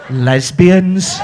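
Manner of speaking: loud scandalised gasp-word; hammed-up gasp of "Lesbians"; mock shocked crowd. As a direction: play a whole audience, shocked. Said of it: "a hundred and fifty people going".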